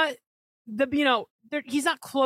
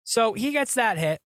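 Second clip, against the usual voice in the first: ordinary delivery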